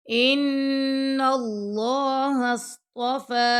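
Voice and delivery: slow, measured tajweed chant; Quran recitation. Shown as a demonstration. Teacher reciting the verse to students, slow and melodic. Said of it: "صاد کو موٹا پڑھیں گے".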